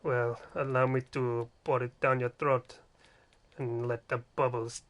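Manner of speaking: low, soft Spanish seducer; mock-seductive telenovela voice, low and unhurried; telenovela suitor. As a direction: play a hammy Spanish lover, slowing towards the end. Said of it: "that shitty telenovela".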